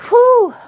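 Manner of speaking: high, loud whew; heavy exhale. Real, not acted. Streamer pulling herself together on a livestream, loud exhale. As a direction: blow out a big steadying breath. Collecting herself after crying.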